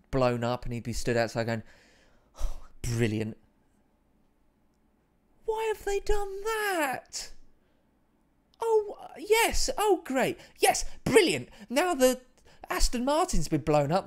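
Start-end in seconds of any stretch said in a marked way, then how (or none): 2.4-14.1 put-on high, drawn-out squeaky posh-boy voice, pitched way up; Simon Bird impression. Streamer doing Simon Bird watching the Aston Martin blow up, high squeaky voice. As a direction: play Simon Bird, bewildered and delighted as the car explodes. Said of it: "That's my Simon Bird impression".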